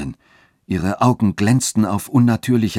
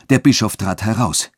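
plain reading voice

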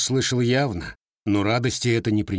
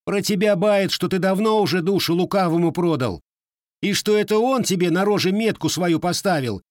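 high, louder youthful accusation; heated accusation; 9 semitones higher, 3 dB louder than usual. A narrator's reading. Grandson accuses the gunsmith of selling his soul. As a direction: accuse him hotly.

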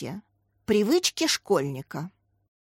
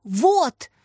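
higher, triumphant exclamation; excited cry; 5 semitones higher, 4 dB louder than usual. A narrator's reading. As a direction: call it out as if proven right. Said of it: "закричал Дима".

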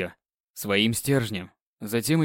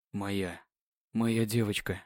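tender; 5 semitones lower, 8 dB quieter, slower than usual. Acted. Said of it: "трепетно звучало".

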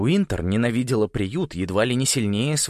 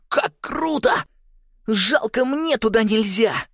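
put-on very high, excited kid's squeal; thrilled; 14 semitones higher, 4 dB louder, slower than usual. A narrator's reading. Нэш longs to visit the school, thrilled. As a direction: burst out in high-pitched excitement.

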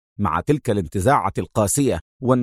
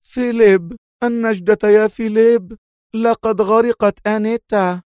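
fake weeping; 10 semitones higher than usual. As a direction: pretend to sob to the police. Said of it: "بصوت متباك".

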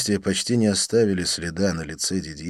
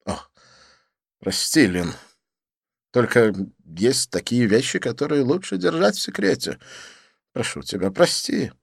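apologetic Didier; 5 semitones higher than usual. Acted. Didier apologizing to Lin, gently. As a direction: say sorry to your friend.

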